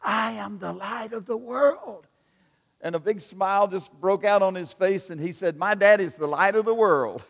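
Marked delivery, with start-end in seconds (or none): 0.0-2.1 dad's loud stage whisper, raised and carrying; a mock whisper meant to carry across the room. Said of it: "whispered in a loud voice". 5.6-7.2 put-on beaming little boy, loud and high; a small boy proudly calling out his line; proud child.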